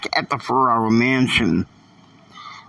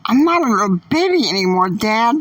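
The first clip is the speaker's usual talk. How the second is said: put-on much higher, fast teen protest; higher indignant girl voice; 11 semitones higher, 3 dB louder, faster than usual. A narrator's reading. Daughter protesting to her father, high fast girl voice. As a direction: rush it out high like a stroppy daughter.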